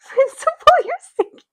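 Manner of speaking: very high, silly little voice; mock high-pitched cry to the principal; squeaky put-on voice. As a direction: squeak it out at the top of your range. Put-on squeaky aside during word search.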